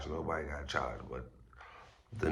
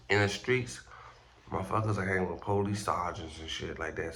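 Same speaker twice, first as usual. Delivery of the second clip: usual speaking voice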